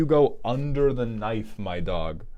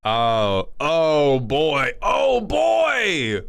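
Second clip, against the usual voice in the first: excited shout; 5 semitones higher, 6 dB louder, much slower than usual. Real, not acted. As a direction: yell it with giddy glee. Excited reaction to war news.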